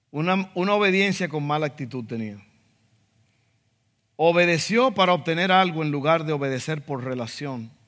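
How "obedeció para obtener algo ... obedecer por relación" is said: normal talking voice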